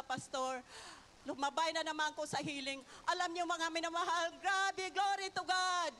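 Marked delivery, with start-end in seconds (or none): 4.4-5.9 fervent praise. Real, not acted. Testimony praise exclamation.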